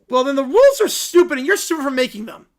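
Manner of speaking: put-on loud high childish outburst; angry kid. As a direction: lash out at the judge. Kid insults judge.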